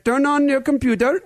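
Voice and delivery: put-on high Indian-accented scam caller; imitated Indian tech-support voice. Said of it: "with a strange Indian accent".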